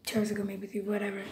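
trailing off under breath; muttered correction. Real, not acted. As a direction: mumble it to yourself, fading. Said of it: "It would make more sense if I just said".

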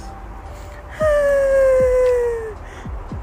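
big, high sigh that breaks into a yelp; loud exasperated sigh. Real, not acted. Exasperated over plant channel remark.

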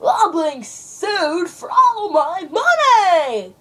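high, slow, drawn-out cry of despair; panicked wail. Acted. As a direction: wail it like a ruined man in an ad. Distressed client repeats his cry.

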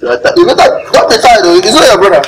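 high-pitched mock pleading; mocking echo. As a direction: throw the plea back mockingly. Mockingly echoes the tearful plea.

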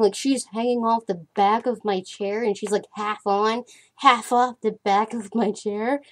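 ordinary delivery

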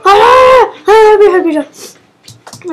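loud high cries for help; loud panicked yelling; panicked shout. Real, not acted. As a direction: scream for help. Being attacked, panicking.

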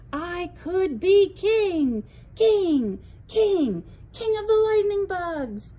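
loud and high, slowing king king king; triumphant cheer. A narrator's reading. Leo crowns himself king of the lightning bugs, loud triumphant cheer. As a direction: crow it like the new king.

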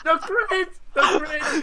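high, laughing out each word. Real, not acted. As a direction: crack up at the hit. Laughing at a critical hit.

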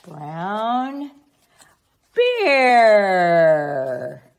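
loud, drawn-out colour announcement; excited reveal. Acted. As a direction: savour each brown treat for the child. Reading a picture book aloud.

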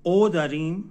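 Turkish o, rounded and open; sound o. Shown as a demonstration. Teacher demonstrating the Turkish o sound, clearly. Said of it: "بعد یه دونه".

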